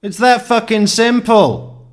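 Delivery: shouted high and loud; loud, high exasperated burst; exasperated outburst. Real, not acted. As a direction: blurt it out in real frustration. Exasperated rant at theorists.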